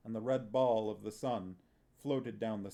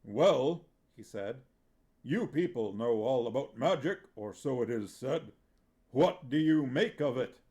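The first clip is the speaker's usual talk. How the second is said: hearty actor manager; 2 semitones higher, 7 dB louder than usual. A narrator's reading. Vitoller asks dwarf.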